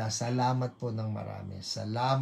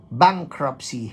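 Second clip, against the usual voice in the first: ordinary talk, nothing marked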